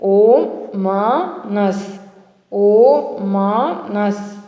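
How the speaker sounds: ఓమనస్ stretched very slowly as o-ma-nas; chunked pronunciation. Shown as a demonstration. Teacher chunking a hard word for students, very slowly. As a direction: draw ఓమనస్ out syllable by syllable. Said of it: "చంకు మెదడులో ఎలా పలకాలి?".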